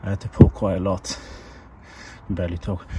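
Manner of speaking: puffing and winded after pulling; out of breath. Real, not acted. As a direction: talk while catching your breath. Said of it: "whew, I'm out of breath".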